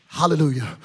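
usual speaking voice

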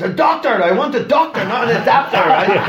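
high, loud mock exasperation; exasperated friend. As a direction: squeal it like the frustrated roommate. Friend's exasperated retort acted.

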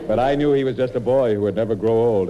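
normal talking voice

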